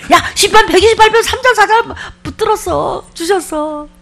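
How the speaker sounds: joyful shout. Retold or imitated. Preacher retelling running out to the chapel, shouting for joy. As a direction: burst out the good news.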